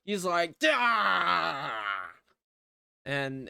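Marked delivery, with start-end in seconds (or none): 0.6-1.7 loud drawn-out "dah" yell, falling off; mock battle yell. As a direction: imitate a struggling attack cry. Imitates attack cry in retold scene.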